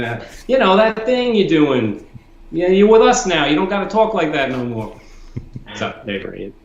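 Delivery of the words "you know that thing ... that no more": imitated New Yorker. New Yorker to friend back from England, imitated scolding. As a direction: mimic a New Yorker laying down the law. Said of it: "New York, people would say".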